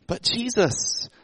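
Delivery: high-pitched mock voice of familiar townsfolk; acted hometown locals. Voices Jesus' hometown people reacting to him.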